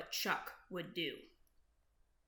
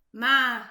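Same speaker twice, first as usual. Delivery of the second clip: much louder mock bleat; goat bleat; 11 dB louder than usual. Goat's bleat in story.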